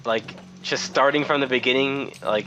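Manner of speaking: ordinary delivery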